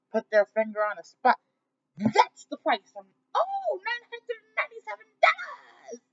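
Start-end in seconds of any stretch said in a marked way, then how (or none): 2.0-6.0 high, loud hammed-up salesman; cheesy announcer unveiling the price; mock price reveal. Mocking coach announcing price.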